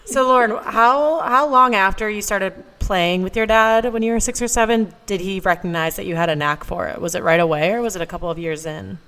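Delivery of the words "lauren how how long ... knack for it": ordinary delivery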